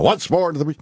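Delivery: high-pitched theatrical declaiming; loud declamation. Retold or imitated. Understudy drilling Henry the Fifth alone, declamatory. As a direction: declaim it like a stage battle speech.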